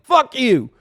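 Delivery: loud, high burst of outrage; play-acted angry outburst; mock fury. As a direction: explode in hammed-up indignation. Acted triggered rant at straight people.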